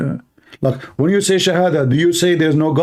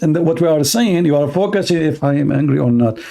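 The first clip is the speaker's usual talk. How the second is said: normal talking voice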